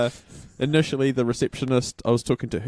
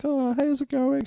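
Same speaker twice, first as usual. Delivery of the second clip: very high, friendly sing-out greeting; imitated lovely nurse. Nurse greets patient led out the back, imitated as lovely and high. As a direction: greet a patient sweetly, pitched right up. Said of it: "She was really lovely".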